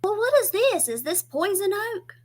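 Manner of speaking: put-on high, fussy old mother voice; mama's voice. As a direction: do your mama worrying over the yard. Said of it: "And she was like".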